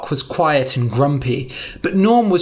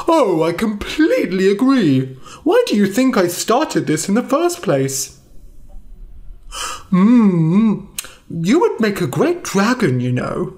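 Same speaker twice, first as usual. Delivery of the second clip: higher, enthusiastic dragon voice; eager dragon agreeing; 5 semitones higher than usual. Acted. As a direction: heartily agree with a friend. Snively agreeing at tea.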